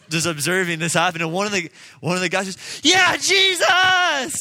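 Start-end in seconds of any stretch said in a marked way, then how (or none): 2.8-4.4 a long, drawn-out shout, much higher and louder; yelled out. Retold or imitated. Bystander in gas station cheering the healing, drawn-out joyful shout. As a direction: bellow it out in sheer joy. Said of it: "just yells it out".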